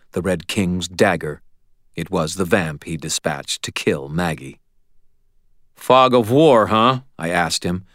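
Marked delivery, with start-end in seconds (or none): 5.8-7.0 louder, higher smug jab; smirking taunt. A narrator's reading. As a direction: taunt him with a smile. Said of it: "felt myself smiling".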